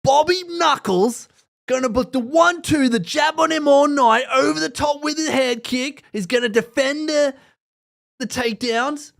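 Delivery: put-on Aussie accent, hyping Whitaker's game plan. Mock Aussie fighter talking up Bobby Knuckles. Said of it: "I hate doing the real Aussie accent".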